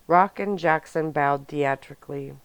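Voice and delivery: ordinary delivery, nothing marked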